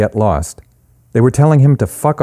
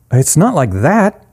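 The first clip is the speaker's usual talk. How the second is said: "It's not like that" higher, lifting at the end like a question; questioning rise; 7 semitones higher than usual. A narrator's reading. Eric objects to Charlie, "that" rising like a question. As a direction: let "that" go up as if unsure. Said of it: "his voice going up at the end as if it were a question".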